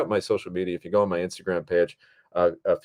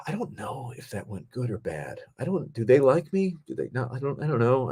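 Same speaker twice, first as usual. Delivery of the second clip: hushed, higher-pitched self-questioning; fretting under your breath with jumpy pitch; unsure; 5 semitones higher, 3 dB quieter than usual. Acted. Second-guessing a first encounter with someone, unsure. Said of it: "where you're like".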